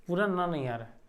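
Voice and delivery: ordinary talk, nothing marked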